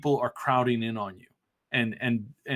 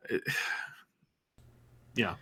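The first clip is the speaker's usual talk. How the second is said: sighing whisper; 3 semitones lower, 8 dB quieter than usual. Real, not acted. Weary sigh over journalists.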